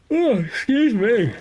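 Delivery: "Oh, excuse me" through a yawn. Real, not acted. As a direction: stretch it out mid-yawn, high.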